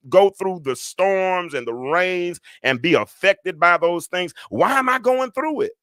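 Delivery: high, emphatic preacher's delivery; impassioned high-pitched sermon voice; preaching. Real, not acted. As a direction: press the questions home from the pulpit. Preaches on failed prayer.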